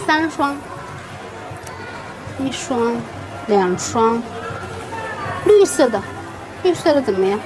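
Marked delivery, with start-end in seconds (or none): none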